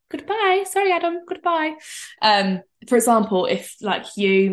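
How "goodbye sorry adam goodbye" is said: high, sing-song goodbye to her friend; airily waving her friend off, much higher; breezy brush-off. Retold or imitated. Imagining leaving her friend: a high, breezy goodbye. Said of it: "I'd be like".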